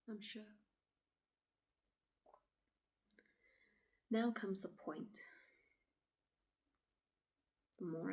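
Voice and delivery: quiet, halting dramatic monologue, long pauses; troubled woman recounting. Acted. Woman telling officer of walking alone at dusk, uneasy and hushed. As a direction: relive it softly, leaving silences between phrases.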